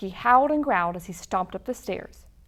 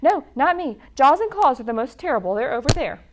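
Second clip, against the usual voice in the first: higher, louder, fast panic; nervous and loud; 4 semitones higher, 7 dB louder, faster than usual. A narrator's reading. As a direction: blurt it out quickly. Scared beast points elsewhere.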